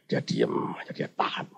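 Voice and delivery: near-whisper, held-breath storytelling; hushed narration. A narrator's reading. Preacher telling of man frozen among creatures, hushed whisper. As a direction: drop to a whisper for suspense.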